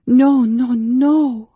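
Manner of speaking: high, loud cry of protest; loud, high-pitched outburst; anguished cry. A narrator's reading. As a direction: cry out urgently to refuse it. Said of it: "Amberol cried".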